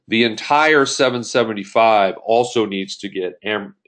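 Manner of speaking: ordinary talk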